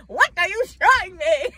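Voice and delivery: a hint of an old-fashioned gentleman; Edwardian gent. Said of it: "You turn into, like, an Edwardian".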